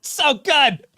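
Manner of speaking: high, sarcastic gushing; mock praise. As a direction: gush it up high, sneering. Mocking the defender's praise.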